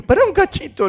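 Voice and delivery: high-pitched plea to hold on; exclaimed "wait a sec"; urgent call to stop. Retold or imitated. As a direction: cut in high to halt someone. Retells shouting stop at child.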